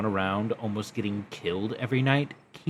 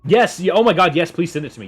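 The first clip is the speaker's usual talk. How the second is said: thrilled, louder and higher; real excitement; excited; 7 semitones higher, 8 dB louder, faster than usual. Real, not acted. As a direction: burst with delight at the gift. Excited reaction to gift.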